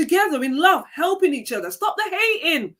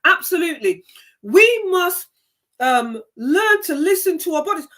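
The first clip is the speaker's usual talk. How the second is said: normal talking voice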